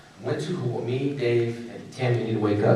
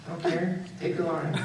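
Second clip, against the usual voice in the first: laughing surrender; 6 semitones higher than usual. Retold or imitated. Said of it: "I was like".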